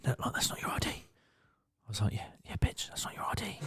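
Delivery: whispered snide aside; mocking whisper. Bouncer mocking the drunk girl to himself, whispered. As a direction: hiss the jab under your breath.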